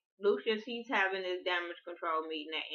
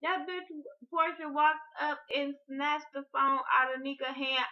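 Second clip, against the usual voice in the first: excited; 8 semitones higher than usual. Real, not acted. Excited retelling of the scene.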